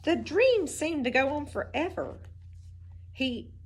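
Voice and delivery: plain reading voice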